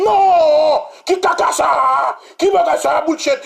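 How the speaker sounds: dragged-out mock fury; mock furious minister. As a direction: ham up the minister's outrage. Minister voiced in retold quarrel.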